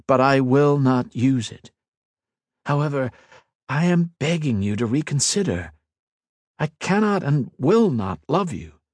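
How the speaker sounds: lightly voiced hero, firm refusal; steady and resolved, begging her to reconsider; firm young hero. Ethan refuses the Queen.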